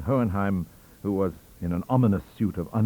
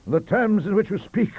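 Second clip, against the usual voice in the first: high, loud Johnsonian outrage; pompous roar; 13 semitones higher, 6 dB louder than usual. A narrator's reading. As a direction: thunder at the vile Whig. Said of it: "he roared".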